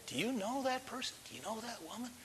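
very soft, near-whispered question; imitated secretive murmur to a companion; hushed aside. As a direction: ask it discreetly under your breath. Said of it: "And somebody's saying".

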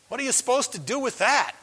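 ordinary talk, nothing marked

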